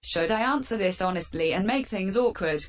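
ordinary talk

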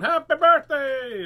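high, loud birthday holler; calling out a greeting. Acted. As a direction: holler happy birthday down the street. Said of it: "wander around the neighborhood going".

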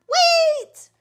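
very high, loud yell; bursting shout. Acted. Froggy bursts up for air to stop his mother, high shout. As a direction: yell to stop someone leaving.

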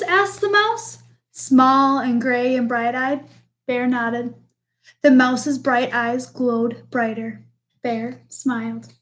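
put-on lively read-aloud for children; storybook reading. A narrator's reading. Teacher reading a picture book to the class, lively storytelling.

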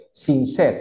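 "Sincero" said as sin-SER, ending on the R; English sincere with no final vowel; silent final E. Shown as a demonstration. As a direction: carefully model "Sincero" without its last vowel. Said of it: "la E no se pronuncia".

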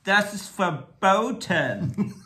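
high, very slow mock-German ban; hammed-up librarian, stretching every word; angry German librarian. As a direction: draw it out, half laughing. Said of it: "like an angry German librarian".

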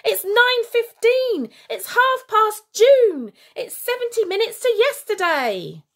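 frantic shout. A narrator's reading. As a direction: babble nonsense times to confuse.

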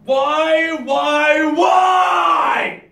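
mock-tragic shouted why; anguished cry. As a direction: howl it to the heavens. Anguished cry over body.

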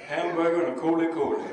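loud, knowing recital of the order; knowing waitress. Retold or imitated. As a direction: finish his order for him.

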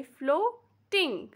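floating slowly as flo-ting; split into syllables. Shown as a demonstration. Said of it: "it breaks into two parts".

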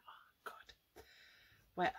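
ordinary delivery

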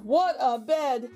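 ordinary delivery

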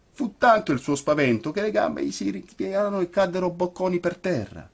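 ordinary delivery

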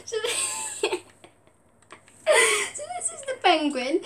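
high, squeaky-pitched words through real giggles; uncontrollable laughing. Real, not acted. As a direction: keep restarting as laughter cuts you off. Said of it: "I can't stop laughing".

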